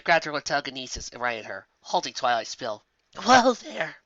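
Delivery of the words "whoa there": soothing princess. Acted. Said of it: "she said".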